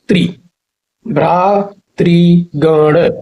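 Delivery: very slow भ्रातृगण, bhraa, tri, gan; syllable by syllable. Shown as a demonstration. Teacher drilling the correct word, भ्रातृगण syllable by syllable. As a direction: separate and stretch each part of भ्रातृगण.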